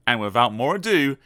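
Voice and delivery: usual speaking voice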